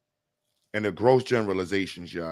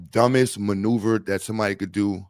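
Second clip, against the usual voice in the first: ordinary delivery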